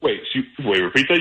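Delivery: usual speaking voice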